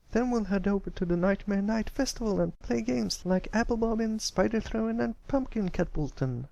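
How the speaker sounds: high filly voice, growing excited. Acted. Apple Bloom telling Pipsqueak about the festival, excited high filly voice. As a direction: list the fun games. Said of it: "Her excitement started to grow".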